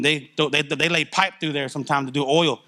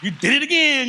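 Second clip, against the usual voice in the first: amazed; 9 semitones higher than usual. Real, not acted. Amazed at God's provision.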